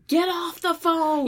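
loud, high, slow shout; yelling. Real, not acted. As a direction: yell at the screen. Yelling at character onscreen.

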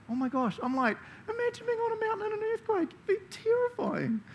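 high, cracking voice of awe; imagine the terror, voice breaking up high; high awed voice close to tears; awed near-crying. Acted. Preacher imagines earthquake, voice cracking.

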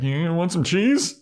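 mock a hopelessly awkward guy, pitch climbing; squeaked-up awkward question. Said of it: "he's all awkward".

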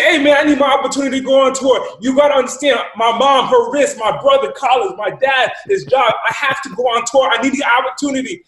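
high, loud frantic plea; yelling his family's troubles at the call; desperate pleading shout. Retold or imitated. Andy begging the president on the group call, high frantic shouting.

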